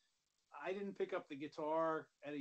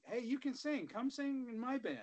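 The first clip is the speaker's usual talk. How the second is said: higher-pitched, imitated recruiting pitch; acted eager invitation; 8 semitones higher, 3 dB louder than usual. Bandmates inviting a singer to join, acted eagerness. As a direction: call him over as an imitated keen bandmate. Acting out bands recruiting him.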